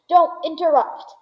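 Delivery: a brisk hammed-up reprimand; sharp rebuke. A narrator's reading. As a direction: cut the boy off sharply. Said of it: "said Mister Wonka".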